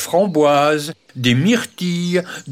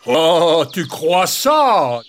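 a touch louder, slow wolf challenge; wolf retort; 3 semitones higher, 4 dB louder, much slower than usual. Acted. The wolf answers the jay, louder and slow.

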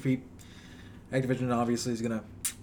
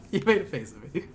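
cracking up mid-read; can't stop giggling; laughing fit; 10 semitones higher than usual. Real, not acted. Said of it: "I'm choking again".

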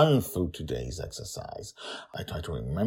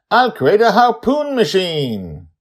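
put-on child voice; 15 dB louder, slower than usual. Joy announcing plan.

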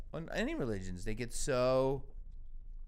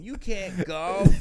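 loud, high, drawn-out mock put-down; mock scolding yell; 8 semitones higher, 11 dB louder, much slower than usual. Parent shooting down kid's golf team hope, mock scolding yell. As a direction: shout it down like an exasperated parent, hammed up.

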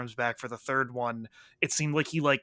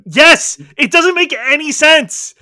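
yelled, much higher, fully fed up; loud outraged agreement; 11 semitones higher, 12 dB louder than usual. Real, not acted. Friend yelling agreement about the plot, outraged. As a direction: shout your agreement at the bad plot.

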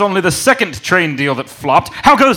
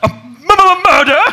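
high, stuttering disbelief; shocked stammer; 12 semitones higher than usual. Acted. Lord stammering at the news of a murder, aghast. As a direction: trip over the word in shock.

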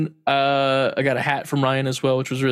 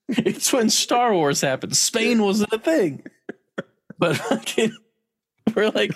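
laughing while telling; 9 semitones higher than usual. Real, not acted. Laughing at the story.

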